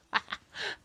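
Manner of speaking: brief breathy burst of laughter; short laugh. Real, not acted. Vlogger amused by fabric argument, short laugh. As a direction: let out a quick real laugh. Laughs after retelling the exchange.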